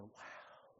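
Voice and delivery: whispered, breathy wow; awestruck hush. Acted. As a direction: let it out under your breath in wonder. Disciples stunned by what Jesus did.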